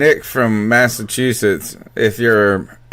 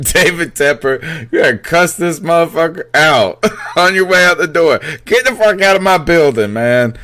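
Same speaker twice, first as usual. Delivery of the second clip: retold yell; 6 semitones higher than usual. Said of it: "he said".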